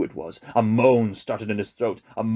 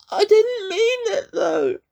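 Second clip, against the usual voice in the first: high, rising plea; 6 dB louder, slower than usual. A narrator's reading. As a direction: push up, desperate. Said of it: "his voice rising".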